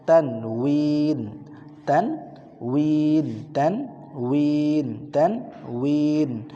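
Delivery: তানউইন stretched slowly, each syllable apart; drawn-out tanwin. Shown as a demonstration. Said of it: "আমরা ভেঙে ভেঙে বলবো". (তানউইন ভেঙে ভেঙে টেনে বলা)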